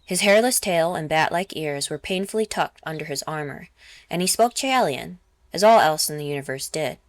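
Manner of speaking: plain reading voice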